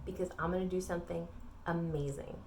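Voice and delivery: hushed, near-whispered promise; confident promise. Acted. God announcing what happens next, hushed and confident. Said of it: "he's gonna roll up his sleeves and say".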